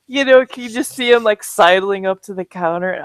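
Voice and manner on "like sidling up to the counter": normal talking voice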